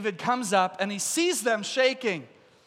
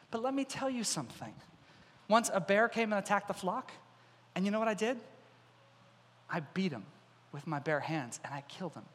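soft, measured, matter-of-fact account; understated acted toughness; quiet confidence; 7 dB quieter than usual. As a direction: state the feat softly, unfazed. David calmly recounts killing bear.

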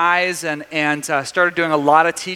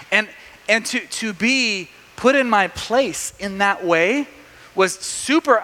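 ordinary delivery

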